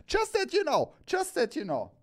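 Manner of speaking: squeaky high joking voice, easing down on the repeat; playful high-pitched. Real, not acted. Host jokingly warning viewers the hat is taken, playfully high. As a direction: pitch it way up for a cheeky claim.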